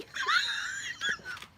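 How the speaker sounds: high, shrill burst of laughter; shrieking laugh. Real, not acted. Vlogger laughing at herself as hopeless moderator, shrieking laugh. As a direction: crack up at herself. Laughing at her own blunder.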